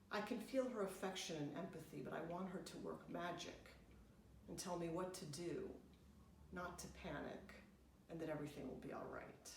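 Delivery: ordinary delivery, nothing marked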